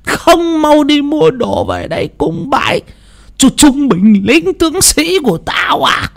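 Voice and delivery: a spirit general speaking through grandma, slow and high; possessed old woman. Acted. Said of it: "cất cái giọng thều thào". (giọng bà lão bị vong nhập, cao và chậm)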